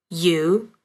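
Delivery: normal talking voice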